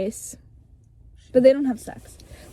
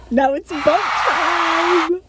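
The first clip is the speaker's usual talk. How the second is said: louder, higher call; playful shout; 6 semitones higher, 4 dB louder, much slower than usual. Real, not acted. Announcing the next part of the story. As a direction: announce it like a party starts.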